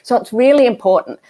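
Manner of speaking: ordinary talk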